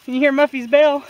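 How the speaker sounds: normal talking voice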